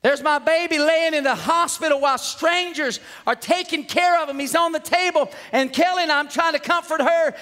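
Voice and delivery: high, urgent pulpit delivery; impassioned preaching. Real, not acted. Preacher relives his baby in hospital, impassioned preaching. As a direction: pour it out high and driving, barely pausing.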